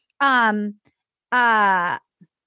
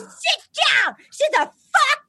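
high, strained yelled order; hammed-up shouting match command; mock angry shout; 11 semitones higher than usual. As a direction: imitate a furious outburst. Acting out Ed's order in quarrel.

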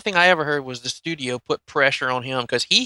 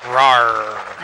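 playful growling roar; monster growl; 4 dB louder than usual. Pretend. Cohost's monster-growl intro.

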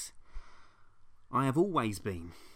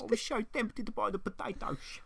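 mock guilty prince; 9 semitones higher than usual. Continuing the prince voice.

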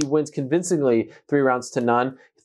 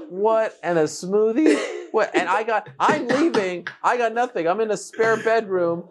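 mock whiny complaint; 7 semitones higher, slower than usual.

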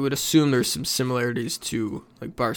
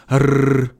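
long, loud trilled R; drawn-out rolling R sound; rolled R; 7 dB louder than usual. Shown as a demonstration. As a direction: show off a sustained tongue-tip trill. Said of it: "I can roll my R's".

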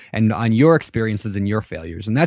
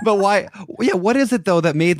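laughing; 9 semitones higher than usual. Real, not acted. Laughing after a joke.

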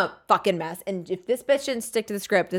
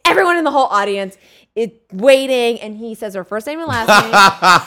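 ordinary talk

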